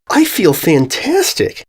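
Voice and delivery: high, slow, bursting with delight; bursting with joy. Acted. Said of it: "I get an urge to shout".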